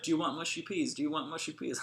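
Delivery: mock British waiter asking a question; imitated British accent. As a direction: lightly put on a British accent. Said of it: "that was my best British accent".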